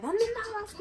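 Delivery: hammed-up scolding mother; imitating mom. Mom texting her angrily.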